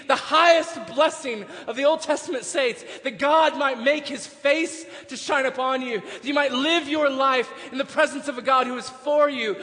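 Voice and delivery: building gospel proclamation at full volume; full-force preaching. Acted. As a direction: preach it hard, voice lifted and driving.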